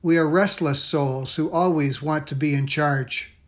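ordinary delivery

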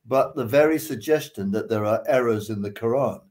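ordinary delivery

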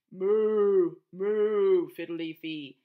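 cow moo. Acted. Cow voice in story.

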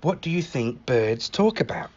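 usual speaking voice

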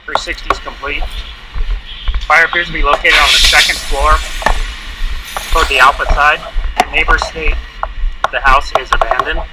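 loud distorted radio report; shouting over a crackling handheld radio; urgent firefighter radio. Real, not acted.

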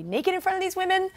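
high, fast protest; mock panic. Telling her friend she won't change, high fast protest. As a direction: squeak it out in a rush of embarrassment. Retold protest about locker room.

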